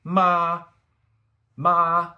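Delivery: long, loud, high level MA; first tone. Shown as a demonstration. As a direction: sing out MA on one high, flat pitch. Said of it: "Let's start from the beginning".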